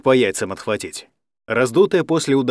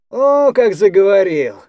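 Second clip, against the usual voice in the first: slow, higher, louder fake wonder; mock admiration; 7 semitones higher, 6 dB louder than usual. A narrator's reading. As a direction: feign being impressed, sarcastic. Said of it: "делано восхитился Серёга". (с деланым восхищением)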